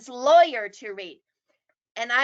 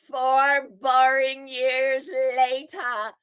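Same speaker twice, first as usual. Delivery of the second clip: slow, drawn-out "Four boring years later"; mock cartoon time-card narration; SpongeBob time card; slower than usual. Shown as a demonstration. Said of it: "like a SpongeBob time card".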